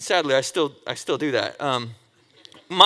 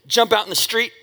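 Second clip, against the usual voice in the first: normal talking voice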